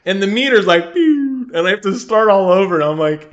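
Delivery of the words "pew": high-pitched "pew" effect; imitated meter drop. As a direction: mimic a game meter emptying, high.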